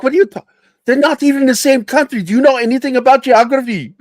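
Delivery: high, fast, indignant mock girlfriend. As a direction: squeal her outraged reply. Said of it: "She's like".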